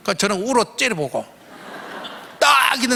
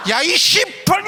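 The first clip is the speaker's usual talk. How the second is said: cursing shout; 9 semitones higher, slower than usual. Retold or imitated. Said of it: "막 하면서 이랬어요".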